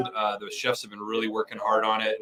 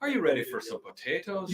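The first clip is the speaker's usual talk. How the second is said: put-on Irish accent; 7 semitones higher, 5 dB quieter than usual. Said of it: "I'm working on my Irish accent".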